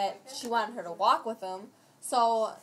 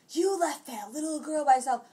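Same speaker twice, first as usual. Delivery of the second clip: higher, louder scolding quote; retold scream; 5 semitones higher, 5 dB louder than usual. Imitating the lady screaming at Lisa, raised mock yell. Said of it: "screaming at Lisa".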